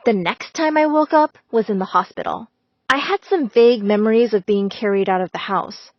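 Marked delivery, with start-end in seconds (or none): none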